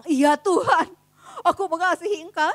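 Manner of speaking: firm and loud, sure of himself; loud bold answer. Acted. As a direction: proclaim it boldly like a confident disciple. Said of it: "Petrus dengan lantang bilang".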